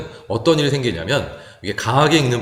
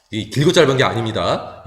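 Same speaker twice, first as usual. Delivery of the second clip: ordinary talk, nothing marked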